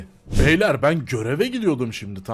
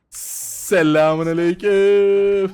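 drawn-out greeting; 6 dB louder, much slower than usual. Pretend.

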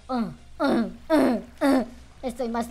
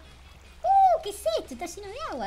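high surprised; 7 dB louder than usual. Real, not acted. Suddenly under water.

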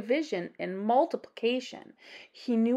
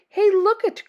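louder, higher call for attention; excited cry; 8 semitones higher, 7 dB louder than usual. A narrator's reading. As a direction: Butch crying out to the class. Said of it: "cried Butch".

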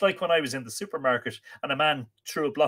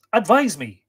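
louder, higher narrated interjection; breaking in; 9 semitones higher, 10 dB louder than usual. A narrator's reading. Art interrupts to ask for counsel, breaking in. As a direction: cut in urgently, pitch and volume up. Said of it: "he broke in".

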